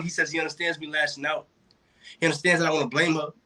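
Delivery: rhythmic slam delivery, settling toward the final reveal; spoken-word poem. Acted. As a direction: recite in driving cadence, then pause before the last line. Poem winds down to diagnosis.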